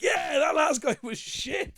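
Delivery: high slow cheer; mock cheering. Comedian joining the magic crowd's cheer, high and slow. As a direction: whoop along with the crowd.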